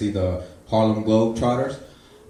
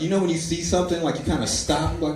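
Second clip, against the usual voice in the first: ordinary talk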